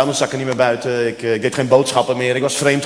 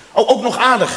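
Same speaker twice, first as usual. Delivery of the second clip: mock jealous wife; 6 semitones higher, 3 dB louder than usual.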